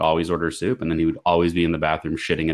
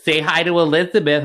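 mom's voice; 8 semitones higher, 6 dB louder than usual. Retold or imitated. Mom voiced in phone story.

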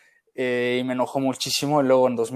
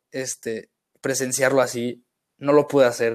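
ordinary talk

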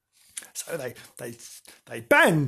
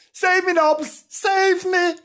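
high, loud panicked plea; frightened kitten; 14 semitones higher, 4 dB louder, slower than usual. Acted. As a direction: scream for help. Kitten chased by dog.

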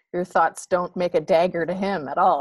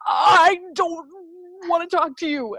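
irritated snap; 11 semitones higher, 4 dB louder than usual. Retold or imitated. Snapping at kid while stewing.

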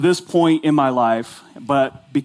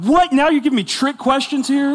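high, outraged squawk of disbelief; retold mock outrage at a trick question; mock indignant surprise; 10 semitones higher than usual. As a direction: act like you've been cheated, pitch way up.

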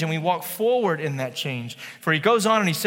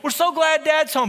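put-on cheerful older kid; 9 semitones higher than usual. Older daughter greeting dad.

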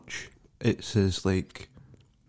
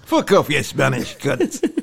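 slow, laughing mock insult in a put-on comic voice; mock comedian insult; 4 semitones higher, slower than usual. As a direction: play a lightly voiced old-school comic heckling. Imitating Bernard Manning insult.

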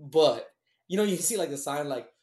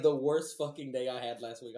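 ordinary talk

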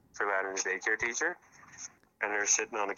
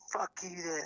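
retold mad partner; 10 semitones higher, 3 dB quieter than usual. Caller mimics partner storming off to shower, mock angry. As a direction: imitate a huffy dismissal. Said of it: "I can't believe how mad she is".

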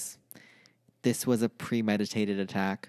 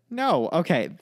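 normal talking voice

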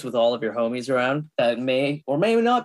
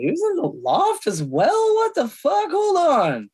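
surprised; 12 semitones higher, slower than usual. Real, not acted. Surprise at actor casting news.

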